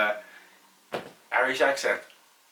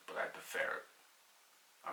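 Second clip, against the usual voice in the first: slow, secret whisper of a confession; whispered aside; 18 dB quieter, much slower than usual. Real, not acted. Rangers fan admitting to viewers he secretly prefers United, whispered. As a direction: breathe it out like a guilty secret. Sneaky admission about Man United.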